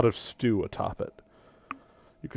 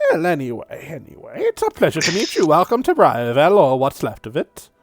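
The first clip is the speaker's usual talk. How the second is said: skeletal archdruid; 3 semitones higher, 4 dB louder than usual. Acted. GM voices archdruid.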